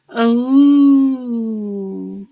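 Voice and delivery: loud sudden gasp and oh; surprised gasp. Real, not acted. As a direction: catch your breath in delight. Reacts to a chat comment.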